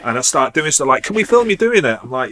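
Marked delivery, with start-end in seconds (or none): 1.0-2.0 high, eager imitated question; eager request. Film crew asking to film him, retold eagerly. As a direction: play the keen visitor, pitched right up.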